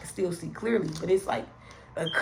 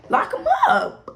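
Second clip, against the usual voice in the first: emphatic shout; 6 dB louder than usual. Retold or imitated. Demanding he be jailed.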